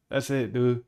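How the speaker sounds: normal talking voice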